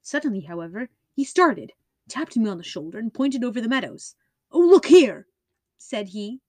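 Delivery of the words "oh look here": high, loud burst of surprise; sudden exclamation. Acted. Holmes calls to his companion with a loud excited exclamation. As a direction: call out sharply, pointing.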